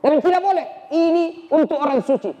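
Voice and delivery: high, firm refusal; firm sister. Acted. Sister refuses to hand Umar the scripture, high firm voice. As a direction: hold the holy text back.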